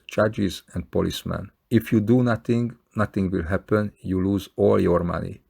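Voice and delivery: ordinary talk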